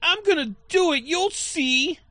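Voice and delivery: put-on high whiny little kid voice; sulky child promising to prove it. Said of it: "he delivers the line like if he's, like, a little kid".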